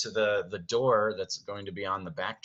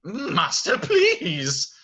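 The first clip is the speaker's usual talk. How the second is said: raised, louder appeal; Lumiere pleading; 11 semitones higher, 6 dB louder, much slower than usual. Acted. Servant stopping furious master, urgent plea. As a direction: stop him with a plea. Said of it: "stops him and says".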